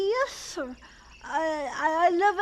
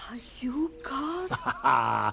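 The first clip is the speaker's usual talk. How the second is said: slow, small hushed question; awed whisper; 4 semitones lower, 4 dB quieter, much slower than usual. Acted. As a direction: ask in wonder, barely voiced. Boy asks in awe.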